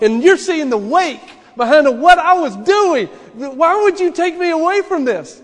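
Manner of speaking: loud, high, protesting plea to God; pleading objection. Pretend. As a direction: argue with the Lord, rising in pitch and volume. Philip imagined objecting to God.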